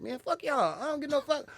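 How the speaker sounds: very high, indignant outburst; high-pitched mock fury at normal volume; retold anger. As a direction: act out lashing back at the booers. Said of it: "So I got mad".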